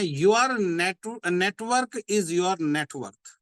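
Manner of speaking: each word of your network is your net worth drawn out very slowly; slow, weighty motto delivery; slow emphatic slogan. Shown as a demonstration. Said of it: "हमेशा एक बात याद रखनी है".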